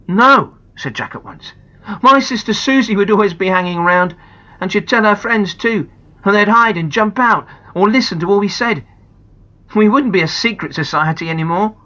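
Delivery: lightly voiced boy; firm boy. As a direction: argue against the woods. Jack refuses firmly.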